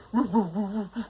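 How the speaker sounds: high yappy woof sounds; dog barking. Acted. Dog calls the cat over, playful high barking. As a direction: bark like a little dog. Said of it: "whilst it sounded like".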